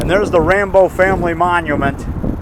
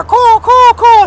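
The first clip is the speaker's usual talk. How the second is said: loud, very high-pitched caws; crow cawing; 7 dB louder, much slower than usual. Retold or imitated. Son recalling the family grave visit, imitates high crow caws. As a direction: caw like crows overhead. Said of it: "crows were up there".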